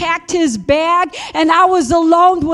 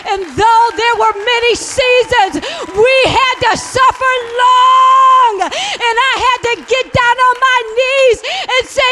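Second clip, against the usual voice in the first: preaching; 4 semitones higher than usual. Acted. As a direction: preach it with rising fire. Preaching her testimony at full force.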